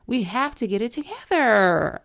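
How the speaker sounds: exasperated yell to rally people; frustrated yelling. Real, not acted. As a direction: cry out the plea in frustration. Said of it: "I feel like I'm screaming this out".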